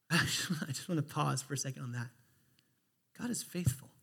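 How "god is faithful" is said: near-whisper, softer; hushed reverence. Real, not acted. Preacher pausing on a promise to the congregation, hushed. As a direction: let it drop to a breath of a whisper. Repeats it after pausing to reflect.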